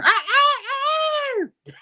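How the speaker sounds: high-pitched imitated crowing cry; mock rooster crow. Chicken Man waking up the house, mock crowing.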